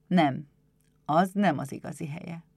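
calm, reassuring agreement; gentle master. Acted. Éder strokes the kobold's head, calm and gentle. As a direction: agree gently, in a natural adult voice.

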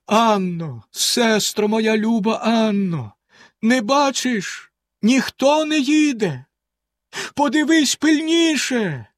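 high, drawn-out desperate cry; slow, wailing plea climbing in pitch; despairing call. A narrator's reading. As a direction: call out one last time in despair. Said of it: "востаннє гукнула з розпукою".

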